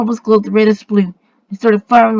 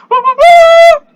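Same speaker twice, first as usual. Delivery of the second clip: high, loud imitated horn blast; trumpet call; 8 dB louder, slower than usual. A narrator's reading. Narrator imitates a strange trumpet behind the travellers, high loud hoot. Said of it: "I heard a strange trumpet".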